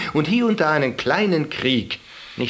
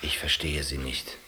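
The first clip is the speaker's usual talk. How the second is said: lower, softer, puzzled; 8 semitones lower, 7 dB quieter than usual. Acted. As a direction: say it gently, almost withdrawn. Quiet reply after outburst.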